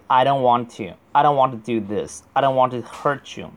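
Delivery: brisk, linked everyday English; fast connected reading. Shown as a demonstration. Teacher shows students how it sounds read fast, words run together. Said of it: "如果读得快的话就".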